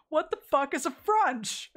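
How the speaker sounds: loud, high-pitched incredulity; mock bafflement. Reacting to the local word for front room, baffled. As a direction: demand an explanation, comically appalled.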